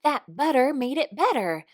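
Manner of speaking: thrilled the ointment worked; excited. A narrator's reading. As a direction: scorpion exclaiming to the doctor about the butter. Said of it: "exclaimed Sting".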